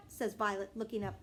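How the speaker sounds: ordinary delivery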